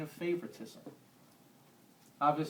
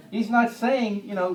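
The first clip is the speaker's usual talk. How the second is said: ordinary talk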